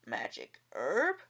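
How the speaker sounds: low, slow growl; suspicious low growl of greed. A narrator's reading. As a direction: drop the voice and growl it out. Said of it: "growled Lai Tong".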